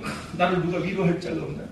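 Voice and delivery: a touch of mopey sighing; mock lament. Believer moaning for comfort, mildly mock lament. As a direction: sigh it out self-pityingly. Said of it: "넋두리 하러 가는 거".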